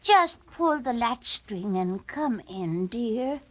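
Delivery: put-on very slow, gentle old lady; kindly grandmother. A narrator's reading. Grandmother inviting the caller in, slow and kind. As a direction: welcome him in like a sweet granny. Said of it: "said the grandmother".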